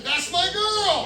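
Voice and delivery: high, drawn-out exclamation; mock excited cry of recognition; delighted recognition. Imagined listener recognising the nurse.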